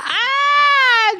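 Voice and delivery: loud, very high, held "ah"; long high-pitched wail; exasperated scream. Real, not acted. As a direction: scream over the caller in exasperation. Exasperated cry at insulting caller.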